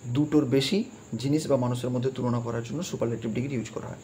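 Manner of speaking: usual speaking voice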